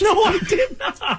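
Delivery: high, slow laughing protest; laughing shout. Real, not acted. Laughing denial of accusation.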